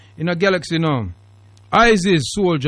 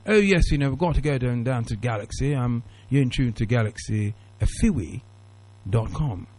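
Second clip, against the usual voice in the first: lower, softer put-on radio presenter voice; mock English presenter; 6 semitones lower, 7 dB quieter than usual. Host mocking how others talk on air, put-on English presenter voice. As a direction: imitate other presenters talking proper English, low and restrained. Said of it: "I don't talk like that. There's others that talk like that".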